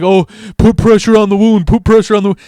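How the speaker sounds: high, loud mock panic; freaked out. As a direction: imitate friends panicking over the blood. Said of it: "freaked out because the blood".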